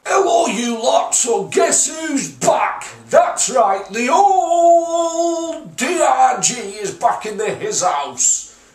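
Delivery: big drawn-out hype-man opening; hyped intro. Real, not acted. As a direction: announce the big comeback, stretching key words. Hammed-up greeting opening the video.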